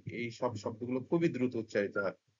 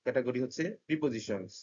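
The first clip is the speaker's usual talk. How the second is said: ordinary talk, nothing marked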